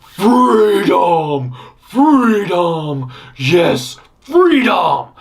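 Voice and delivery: loud drawn-out cheer of release; triumphant completionist cry. Acted. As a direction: cry it out like being set free. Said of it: "the completionist in me is like".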